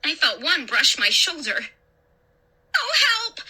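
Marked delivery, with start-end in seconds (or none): 2.7-3.4 high-pitched cry for help; frightened, high plea. A narrator's reading. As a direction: call out in panic. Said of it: "I cried".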